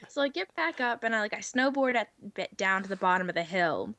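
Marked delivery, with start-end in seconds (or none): none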